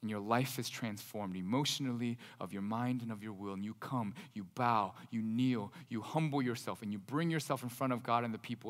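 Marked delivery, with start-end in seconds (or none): none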